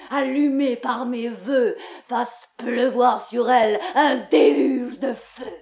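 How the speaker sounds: ordinary talk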